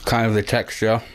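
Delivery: muffled through chewing; chewing while talking. Real, not acted. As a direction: mouth partly full. Seen 1: Put candy in mouth at -5; jaw and cheeks working throughout.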